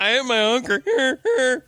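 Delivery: high, drawn-out words through real laughter; laughing mockery. Real, not acted. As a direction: crack up while repeating a silly word. Cracking up, mocking the word honker.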